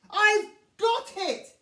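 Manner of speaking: high, loud shout of delight; excited cry. A narrator's reading. Rhino gets a new idea, cries out excitedly. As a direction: blurt it out, thrilled. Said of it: "he cries".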